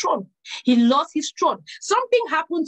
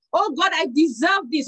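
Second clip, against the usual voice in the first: ordinary talk, nothing marked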